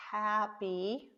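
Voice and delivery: "happy" stretched slowly, syllable by syllable; sounding out. Shown as a demonstration. Teacher helping kids read, sounding out "happy" slowly. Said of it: "you could sound out the word".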